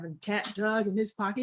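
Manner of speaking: usual speaking voice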